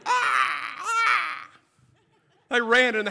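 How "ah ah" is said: high mock screams of the girls; imitated scream. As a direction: scream like frightened teenage girls. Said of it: "They were screaming".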